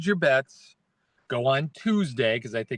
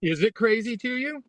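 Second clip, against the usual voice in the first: teasing question; 8 semitones higher than usual. Real, not acted.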